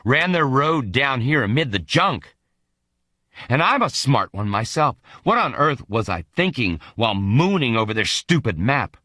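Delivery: usual speaking voice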